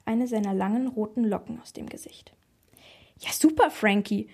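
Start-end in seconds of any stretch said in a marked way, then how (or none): 3.2-4.3 happy little burst of praise; delighted. A narrator's reading. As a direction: cheer on your grandson. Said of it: "freute sich meine Oma".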